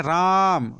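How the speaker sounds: राम with a long, sustained aa; prolonged vowel. Shown as a demonstration. Teacher repeating the pluta swar example, राम greatly drawn out. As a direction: hold the middle of राम for several beats before closing. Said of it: "जैसे आवाज़ निकाल रहे हैं".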